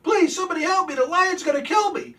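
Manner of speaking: high, loud hammed-up cries for help; panicked screaming. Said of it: "He starts freaking out, screaming, yelling".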